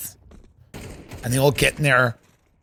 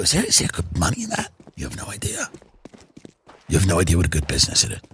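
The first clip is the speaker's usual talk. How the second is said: sleazy rich guy; 10 semitones lower, much faster than usual. Retold or imitated. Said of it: "And then they go".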